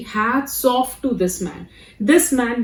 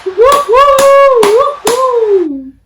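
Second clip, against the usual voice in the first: high, loud whoops; cheering; 12 semitones higher, 8 dB louder, much slower than usual. Real, not acted. Fan cheering the comic's bit, whooping.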